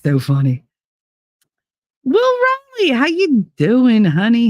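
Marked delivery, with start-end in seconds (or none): 2.0-4.5 bright high-pitched hello to a viewer; high excited greeting. Real, not acted. Streamer greeting a viewer by name, high and excited. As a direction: greet them up high and eager.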